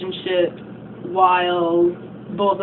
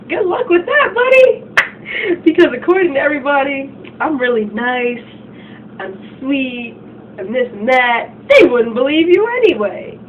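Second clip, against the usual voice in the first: put-on high, loud sneering mean-girl taunt; acting out a smug mean girl, much higher and louder; 6 semitones higher, 4 dB louder than usual. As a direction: gloat over her, mockingly sweet.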